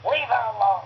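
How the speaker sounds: put-on raspy Boston girl protesting; hammed-up smoker-voiced plea; Jenny Miller. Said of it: "Jenny throws herself in between them and goes".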